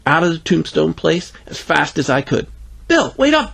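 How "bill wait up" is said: put-on high-pitched girlish call; yelling after him. A narrator's reading. Greta calls to Bill walking away, high mild yell. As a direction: call out like a child left behind. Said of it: "Greta yelled".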